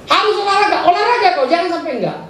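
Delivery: high, insistent exhortation; preacher urging the congregation to exercise, high and insistent; emphatic urging. Acted. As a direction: push them to exercise.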